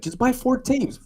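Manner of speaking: very high, coaxing pitch; easygoing salesman. Retold or imitated. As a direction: make it sound like nothing. Acting out his sales pitch.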